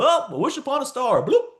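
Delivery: high, airy wishing-well voice with a plop; mock a wishful dreamer; mock dreamy wisher. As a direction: coach mocking people who just wish.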